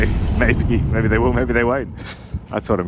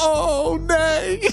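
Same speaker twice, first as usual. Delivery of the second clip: put-on drunk driver yelling. Retelling a drunk courier on the floor, high drunken wail. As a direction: howl it like a fallen drunk. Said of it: "And then go".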